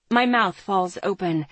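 ordinary delivery

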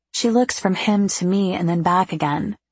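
usual speaking voice

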